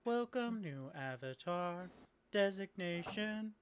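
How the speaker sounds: courteous automaton, word by word; polite robot quest-giver. Acted. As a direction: speak in held, stepped machine tones. Robot quest-giver greets new summon.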